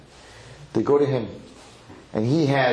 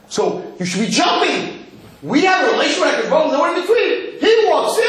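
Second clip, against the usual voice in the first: high, loud cry building to a shout; raised-voice jubilant exhortation; exultant preaching; 6 dB louder than usual. Real, not acted. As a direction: preach it at full force, pitch climbing to the top. Preacher urges exultant jumping for joy.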